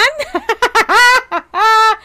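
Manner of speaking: high, loud burst of laughter; loud cackling laugh. Real, not acted. Streamer laughing with Oban about her card, loud cackle.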